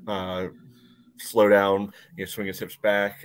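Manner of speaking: usual speaking voice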